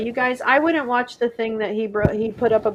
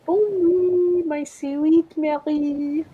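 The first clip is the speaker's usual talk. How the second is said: sing-song goodnight; 5 semitones higher, much slower than usual. Pretend. Playful goodnight to Marie.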